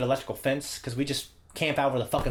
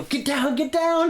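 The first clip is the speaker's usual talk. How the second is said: urgent; 13 semitones higher, 4 dB louder, slower than usual. Retold or imitated. Hiding from dad, urgent.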